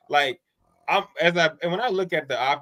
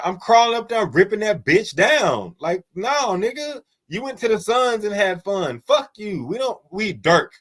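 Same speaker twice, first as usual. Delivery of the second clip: higher-pitched, mock-indignant fan rant; outraged fan talk; 6 semitones higher than usual. Acting a Dallas fan objecting to a banner, mock outrage. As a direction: protest like a fan who feels betrayed. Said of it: "that's fan talk".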